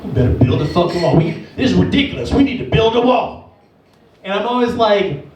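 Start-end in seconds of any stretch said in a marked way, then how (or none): 0.0-3.6 low, fast grumbling rant. Retold or imitated. Relatives ranting low and fast about the wall. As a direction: rant like an angry uncle. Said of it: "They're like".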